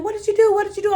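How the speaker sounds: high-pitched acted outburst; going off. Dreamer feeling her head and confronting captors, going off. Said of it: "I'm going off".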